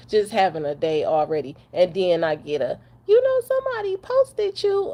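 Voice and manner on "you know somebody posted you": high, mock-cheery voice of the one telling her; messenger voice. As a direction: play the person breaking the news in a high put-on voice. Someone tells her about post.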